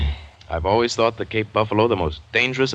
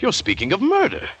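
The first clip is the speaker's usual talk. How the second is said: sharp, higher protest; indignant outburst; 12 semitones higher than usual. Acted. Guest accusing the general of murder, indignant. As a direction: throw the accusation back at him, shocked.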